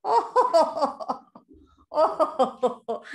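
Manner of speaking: hammed-up "oh-ho-ho" laugh; big round storybook chortle; mocking animal laughter. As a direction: laugh at the silly bear. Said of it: "the squirrel and the ducky were laughing".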